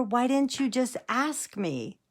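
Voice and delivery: high, drawn-out exasperated retort; mock exasperation. Granddaughter finally understands grandpa, mock exasperation. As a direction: stretch the name, then scold in disbelief.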